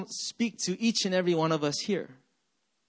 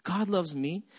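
softer "God loves me?" with a rise at the end; questioning; 2 semitones lower, 5 dB quieter than usual. Shown as a demonstration. Preacher repeats his doubt to the congregation, softly questioning. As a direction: wonder "God loves me?" quietly, voice going up. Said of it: "It came out like".

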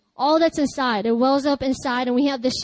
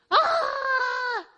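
high, desperate wordless cry; shout of desperation; 10 semitones higher, 3 dB quieter than usual. Shown as a demonstration. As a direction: let out a raw, desperate yell. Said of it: "shout to God in a place of desperation".

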